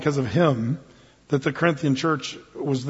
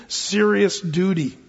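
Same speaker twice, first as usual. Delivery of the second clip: ordinary talk, nothing marked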